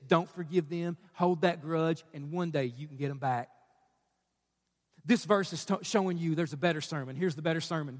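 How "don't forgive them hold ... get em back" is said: low, quiet urging; bitterness's voice. Acted. Preacher voices bitterness to the grudge holder, low and quiet. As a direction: counsel revenge softly, pitch dropped. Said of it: "the sermon of bitterness says".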